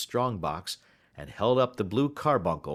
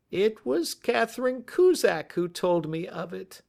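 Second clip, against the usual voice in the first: cracking voice; 12 semitones higher, 4 dB louder than usual. A narrator's reading. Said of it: "in a cracking voice".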